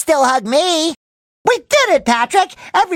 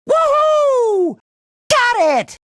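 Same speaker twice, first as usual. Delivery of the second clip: very high, drawn-out whoop; joyful whoop; 12 semitones higher, much slower than usual. Acted. Hero celebrating a find, high shouted whoop. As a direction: let out a long, high cheer.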